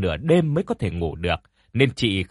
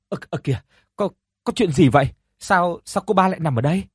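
panicked asking; 6 semitones higher, faster than usual. A narrator's reading. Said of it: "Hoảng hồn, chị liền lên tiếng hỏi".

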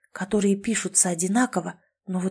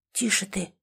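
softer shushing; hushing; 6 dB quieter than usual. A narrator's reading. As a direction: shush her quietly. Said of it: "шикнул на неё голос".

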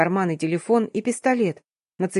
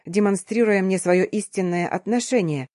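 ordinary delivery

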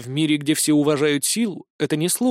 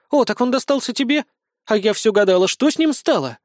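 high, bright-eyed elf lady; a curious elf lady perking up; lively elf lady; 6 semitones higher than usual. Acted. Alkaniel voiced, lively.

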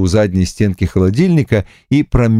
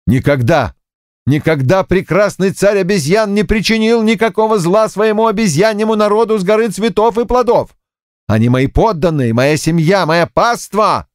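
indignant outburst; 11 semitones higher than usual. Acted. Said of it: "Узкоглазый повар взорвался".

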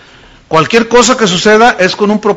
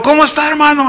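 cheerful greeting; 9 semitones higher, faster than usual. Retold or imitated. Imitating his own cheerful greeting.

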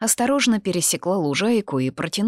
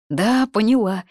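higher, louder cry of realization; exclaiming; 6 semitones higher, 6 dB louder than usual. A narrator's reading. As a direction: exclaim as it suddenly clicks. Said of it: "воскликнула Лита". (радостно-догадливое восклицание)

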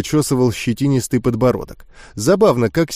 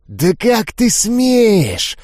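slow, hissed outrage; hissing ghouls; 8 semitones higher, much slower than usual. Acted. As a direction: spit each word slowly in offence. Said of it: "зашипели Гули". (злое медленное шипение)

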